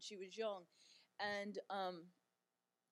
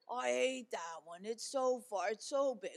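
mock whine; 4 dB louder than usual. Mother imitating her daughter whining about Atlanta. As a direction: whine it like a kid who hates the airport.

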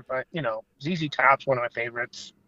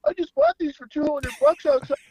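high, mock-innocent excuse; playing stupid; 11 semitones higher than usual. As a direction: act the clueless fan caught out, voice up high. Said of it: "I'm playing stupid".

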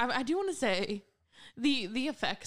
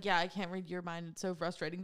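mock frustrated sigh; 4 semitones lower, 6 dB quieter than usual.

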